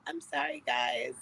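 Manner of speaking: small, high, soft voice; pouting apology. Retold or imitated. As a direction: apologise meekly with a pout. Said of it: "I was just pouting for a minute".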